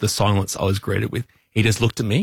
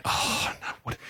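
breathless, whispered panic; panicked whisper; 10 dB quieter than usual. Retold or imitated. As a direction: gasp it out under your breath in panic. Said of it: "I'm like".

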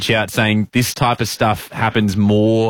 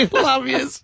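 laughing; 13 semitones higher than usual. Real, not acted. Playful sign-off.

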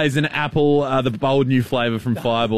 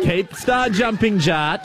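coach shout; 7 semitones higher, slower than usual. Pretend. Calling out to co-host.

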